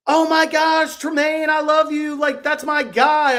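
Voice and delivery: high, far squealing fan voice, slow at the end; imitating a gushing girl; excited girl. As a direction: gush like a starstruck fan. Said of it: "And she goes".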